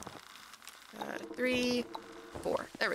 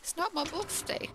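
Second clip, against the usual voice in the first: pitched way up, shrill insistence; repeated protest much higher; higher indignant protest; 10 semitones higher than usual. Acted. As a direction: insist harder, voice climbing high.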